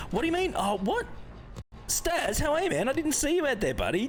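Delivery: high, playful mock-surprised hello; mock surprise. Real, not acted. Streamer teasing a friend in chat, mock surprise. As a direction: pretend you just spotted him.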